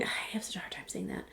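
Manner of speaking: quick, near-whispered aside; muttered aside. Real, not acted. Vlogger to herself after a tricky scent name, muttered. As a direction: drop to a fast, hushed mumble.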